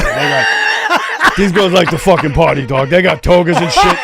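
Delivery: loud, high, laughing impersonation; hammed-up rowdy friend; excited retelling. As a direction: holler it through laughter, hyping up the party. Acted-out excited friend in story.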